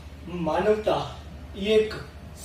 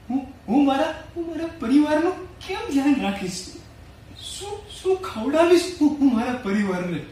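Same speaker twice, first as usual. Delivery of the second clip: higher-pitched, urgent self-questioning; acted worry; 5 semitones higher, faster than usual. Recalling fears of feeding the family in the pandemic, acted worry. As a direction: fret aloud, pitch raised, stumbling on the first words. Voicing own worried thought about family.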